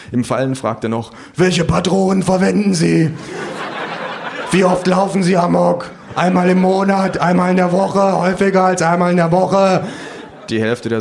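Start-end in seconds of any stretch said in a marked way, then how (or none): 1.4-9.9 loud, high, strained questioning; high, forceful put-on voice; dying pollster. A narrator's reading. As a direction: cry out the survey while going down. Shot pollster still asks while falling.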